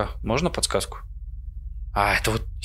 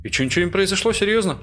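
surprised; 9 semitones higher, faster than usual. Real, not acted. Expected scare, nothing happened.

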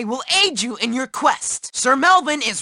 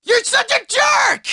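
high-pitched indignant yell; angry outburst; 8 semitones higher than usual. Acted. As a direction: yell at the bully. Nerd yelling at his bully.

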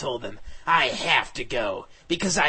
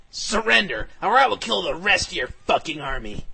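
loud, raised demand to surrender; fierce threat; 4 semitones higher, 7 dB louder than usual. Acted. Hero ordering the villain to surrender, fierce threat. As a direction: threaten hard, punching it out.

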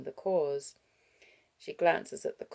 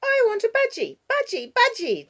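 put-on very high, loud child's yell; 11 dB louder than usual. A narrator's reading. Six-year-old yelling at grandparents for a budgie, tantrum yell. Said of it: "Jania yells".

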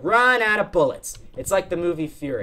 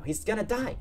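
ordinary delivery

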